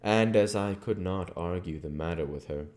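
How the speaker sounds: plain reading voice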